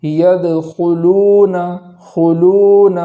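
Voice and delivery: يَدْخُلُونَ slow, long و; slow tajweed demo. Shown as a demonstration. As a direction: stretch و one count. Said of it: "اس طریقے سے پڑھیں گے".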